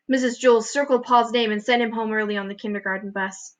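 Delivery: plain reading voice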